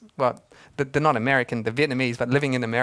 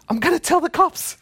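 mock alarmed; 11 semitones higher than usual. Pastor's comic answer.

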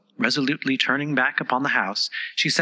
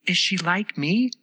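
eager; 6 semitones higher, slower than usual. A narrator's reading. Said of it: "Alice asked eagerly".

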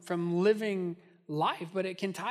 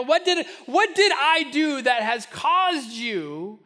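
rising louder and higher, pleading; building pleading cry; 5 semitones higher, 7 dB louder than usual. Acted. God pleads with his people, building to a cry. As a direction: plead harder with each question. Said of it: "He says".

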